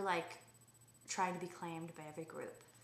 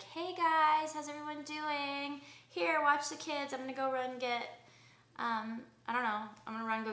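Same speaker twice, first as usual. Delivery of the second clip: bubbly park mom; 8 semitones higher, 6 dB louder than usual. Pretend. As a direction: play the gushing social mom greeting everyone. Imagining herself as park mom.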